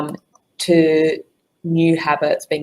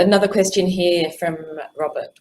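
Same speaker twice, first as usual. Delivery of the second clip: usual speaking voice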